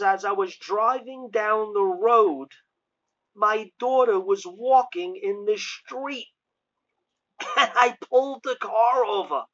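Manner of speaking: imitating excited woman. Preacher voicing the mother's reunion story, imitating her excitement. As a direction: bubble over like the overjoyed mother.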